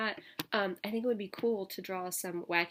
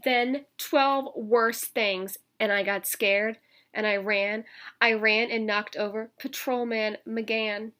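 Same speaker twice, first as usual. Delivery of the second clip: lively storybook read-aloud; reading to children; 9 dB louder, slower than usual. A narrator's reading. Teacher reading a picture book to children, lively. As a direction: read it out to the kids with bounce.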